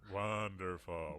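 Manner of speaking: low, drawn-out echo; lingering, mock-appreciative WONDERFUL. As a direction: stretch WONDERFUL out slowly and low. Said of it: "let me try that again".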